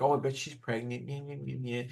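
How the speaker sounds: muttered, mocking imitation of gossips; mocking naysayers. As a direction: trail into a soft, whiny taunt. Imitating doubters who bet against them.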